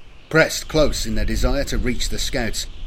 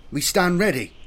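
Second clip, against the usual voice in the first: higher-pitched, firm declaration; declared readiness; 7 semitones higher than usual. A narrator's reading. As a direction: declare it like a soldier reporting. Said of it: "Velas declared".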